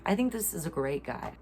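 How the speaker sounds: ordinary talk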